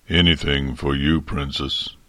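low dragon murmur that still carries; a giant trying to speak gently. A narrator's reading. Giant dragon gently promises the princess to stay, low murmur. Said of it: "he murmured".

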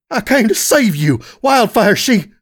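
high, impassioned cry; desperate outburst. Acted. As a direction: plead loudly, breaking off mid-sentence. Desperate plea to long-lost brother.

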